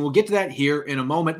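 normal talking voice

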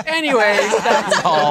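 giggling deadpan sign-off; laughing quote. Retold or imitated. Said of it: "story of cannibalism ending with".

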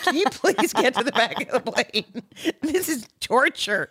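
high, pleading traveller's complaint; exasperated mock plea. Fed-up passenger to noisy toddlers, exasperated mock plea.

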